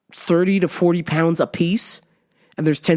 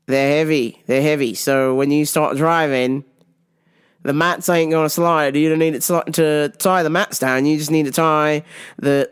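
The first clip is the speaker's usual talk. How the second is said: lower, badly faked Australian accent; terrible Aussie accent; 4 semitones lower than usual. Ellis reassuring about the mats, bad Aussie accent. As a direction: do your mate in hammed-up Aussie. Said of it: "that terrible Aussie accent".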